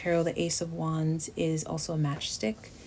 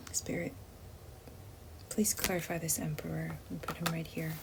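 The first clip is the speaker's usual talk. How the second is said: soft, reverent whisper; whispered prayer; 8 dB quieter than usual. Real, not acted. Reader praying to Spirit for clarity, whispered. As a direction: ask the spirit quietly.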